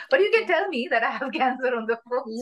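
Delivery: a bitter laugh breaking through the words; laughing in disbelief. Real, not acted. Telling a friend about the doctor's call, laughing in disbelief. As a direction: laugh at how absurd it was.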